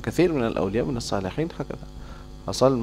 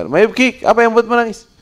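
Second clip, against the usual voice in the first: very high, loud mocking question; angel of death asking; 11 semitones higher, 6 dB louder than usual. Angel of death questioning the weeping man, very high. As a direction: demand to know why he cries.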